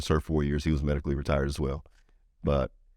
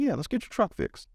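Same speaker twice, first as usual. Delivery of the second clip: upbeat offer. Retold or imitated. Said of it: "I said".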